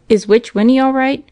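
a father calling out in alarm; anxious cry. A narrator's reading. Father jumping from his horse, calling out anxiously. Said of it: "cried Mister Graham".

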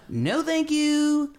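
very high, slow, drawn-out no thank you; the athleticism in him begging off in a squeak; squeaky mock refusal. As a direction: stretch it out in a tiny high voice. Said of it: "All my athleticism said".

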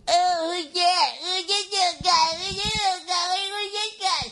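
put-on very high cooing baby talk to a dog; annoying dog-talk voice. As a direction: coo at the pit bull like a doting stranger. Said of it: "most annoying I'm talking to a dog voice".

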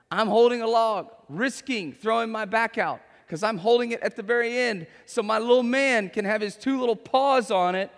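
ordinary talk

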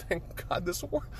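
high, soft, near-tearful voice; choked-up relief. Retold or imitated. Stark hugging Parker, retold with choked-up relief. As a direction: barely get it out through welling tears. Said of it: "look on Stark's face is just like".

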